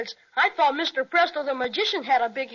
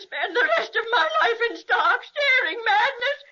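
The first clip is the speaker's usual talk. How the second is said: high, laughing-crying witch lament; cackling sob of a witch; wailing witch; 6 semitones higher than usual. Acted. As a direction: wail it like a cracked-up witch.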